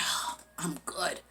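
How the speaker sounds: slow, very soft whisper; hushed relief. Retold or imitated. Heroine thinking she got away, whispered relief. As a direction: let it out under the breath.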